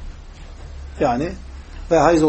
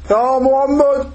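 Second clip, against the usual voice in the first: high-pitched imitation of a Bedouin calling out; imitated loud call; 6 semitones higher, much slower than usual. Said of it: "Peygamber'e yüksek sesle bağırdı".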